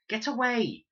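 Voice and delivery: put-on girl yelling at vultures to scare them. A narrator's reading. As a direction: shout the birds away. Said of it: "yelled Leela".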